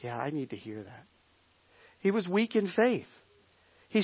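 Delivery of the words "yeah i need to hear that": very soft, low retold answer; subdued reply. Struggling Mike answers his reassuring friend, subdued and very quiet. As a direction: drop right down, as if humbled.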